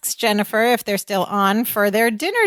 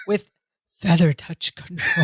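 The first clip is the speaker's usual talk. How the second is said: dramatic breathy voice; 5 semitones lower than usual. Retold or imitated. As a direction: breathe it like an ad slogan. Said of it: "dramatic, breathy voice".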